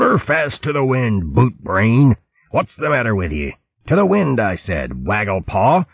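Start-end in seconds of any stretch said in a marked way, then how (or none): 3.9-4.4 higher pitched shouted order, stress on "the"; repeated order. Acted. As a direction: hammer "To the wind" with the stress on "the". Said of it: "Chopsnout roared".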